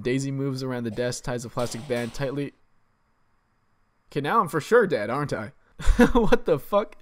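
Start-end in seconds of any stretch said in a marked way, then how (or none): 5.8-7.0 loud, slow laughing disbelief; shocked laugh. Real, not acted. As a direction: cry out in disbelief at dying again. Shocked at dying.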